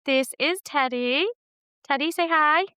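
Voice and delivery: high, sing-song talk to a cat; pet talk voice. Real, not acted. Talking to the cat.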